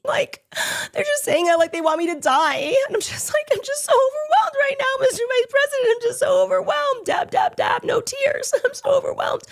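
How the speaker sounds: very high, wailing fake sobs; mock crying Erica. Host mocking Erica's fake tears on the phone, very high wail. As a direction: ham up crocodile tears, dabbing dry eyes. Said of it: "She's like".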